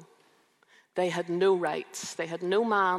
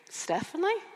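puzzled receptionist; 13 semitones higher than usual. Retold or imitated. Receptionist asking, voiced in story.

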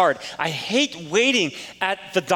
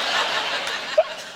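a squeak of high laughter; cracking up at own joke; high airy laugh; 3 dB quieter than usual. Real, not acted.

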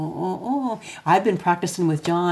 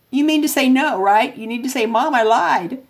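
higher, a little louder mock-scolding mother; mock stern mom; 7 semitones higher, 3 dB louder than usual. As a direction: catch him in the lie, pitched up. Mother scolding son's lie, retold.

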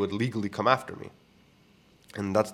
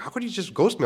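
hurt indignation; 10 semitones higher than usual. Retold or imitated. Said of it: "I was so angry".

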